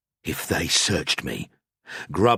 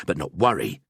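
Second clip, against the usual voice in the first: ordinary delivery, nothing marked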